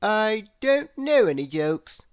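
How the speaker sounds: a hint of sheepish admission; lightly voiced, crestfallen; Mister Clever admitting he has no jokes; deflated Mister Clever.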